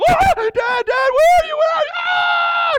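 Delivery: put-on high, loud, frantic child calling for Dad; panicked child. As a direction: shriek like a scared kid lost in the dark basement. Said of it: "then they freaked out".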